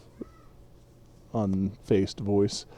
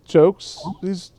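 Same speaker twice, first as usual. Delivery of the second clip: higher-pitched, halting hobbit voice; hesitant tipsy hobbit; 7 semitones higher, 3 dB louder than usual. Acted. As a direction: pick your words haltingly, voice raised. Game master plays tipsy Frodo.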